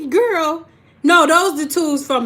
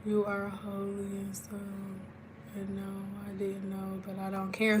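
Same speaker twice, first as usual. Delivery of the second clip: much softer, low, hushed musing; dreamy inner voice; 3 semitones lower, 14 dB quieter than usual. Retold or imitated. Streamer voicing her own thoughts to chat, much softer. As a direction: say it under your breath to yourself. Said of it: "I'm like".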